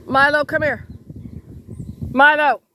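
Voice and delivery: loud call to the dog; sharp loud call. Real, not acted. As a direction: shout the dog's name across the room. Calling dog with something in mouth.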